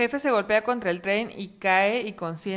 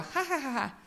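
character laugh; 5 semitones higher than usual. Acted. Risa del personaje.

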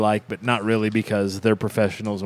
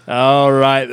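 louder, higher, through laughter; real laughing; 3 semitones higher, 4 dB louder, slower than usual. Real, not acted. Host trying to move on from Bucky joke, laughing. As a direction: laugh while waving off the joke.

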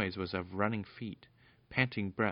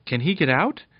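anxious question; 6 semitones higher, 11 dB louder than usual. Acted. Scared Peter asking.

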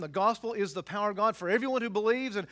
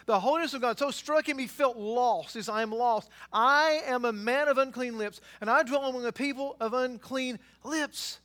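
loud, soaring pulpit delivery, higher and louder; preaching at full force; 3 semitones higher, 5 dB louder than usual. Acted. Pastor thundering about God's holiness to his church, at full force. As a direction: drive the holiness of God home to the congregation.

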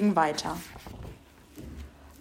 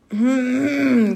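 loud, high closed-lipped hum; muffled ventriloquist's hum; closed-mouth grumble; 4 semitones higher, 5 dB louder than usual. A narrator's reading. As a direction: grumble without opening the lips. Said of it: "grummelte er mit geschlossenem Mund".

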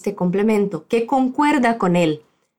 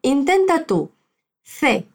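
ordinary delivery

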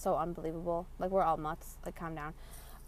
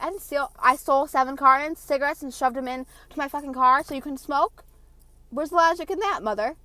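raised, high-pitched argument, reenacted; indignant retort; 6 semitones higher, 9 dB louder than usual. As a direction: argue back loudly at an unreasonable mother. Arguing back at mom, acted.

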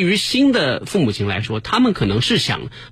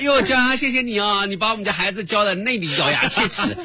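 mock fake-polite mother; 7 semitones higher than usual. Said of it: "表面话谁不会讲".

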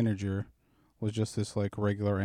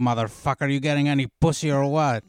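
mock gruff old Greek guy, louder; through gritted teeth; 4 semitones higher, 8 dB louder, faster than usual. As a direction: growl it through clenched teeth at the manager. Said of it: "he's, like, gritting his teeth".

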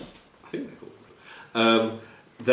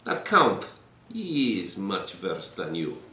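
put-on Russian-accented prisoner; 3 dB quieter than usual. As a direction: brush off the threat, accented. Prisoner defies the officers.

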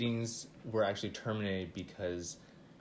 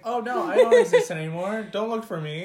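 mocking; 10 semitones higher, 12 dB louder than usual. Mocking fake death quote.

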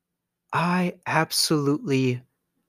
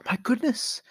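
high, amazed, gushing mark; gullible mark; 8 semitones higher than usual. Pretend. Mark marvelling at the prince's ten million offer, high and amazed. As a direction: gasp at the fortune like a sucker.

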